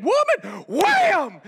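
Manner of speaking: high, exasperated mock shout; mad outburst. Paul fed up with the girl following him, mad outburst. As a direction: burst out like you've had enough. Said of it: "Paul gets so mad".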